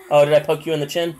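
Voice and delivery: ordinary talk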